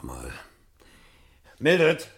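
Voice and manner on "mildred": loud, high call through the house. Acted. Montag calls loudly through the house for his wife. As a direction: shout for her from far away.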